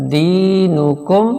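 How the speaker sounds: دینکم with the دی drawn out slowly; slow recitation, دی held long; stretched دی. Shown as a demonstration. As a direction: lengthen the ee in دینکم. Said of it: "دی پہ تھوڑا سا آواز کو کھینچ لیں گے".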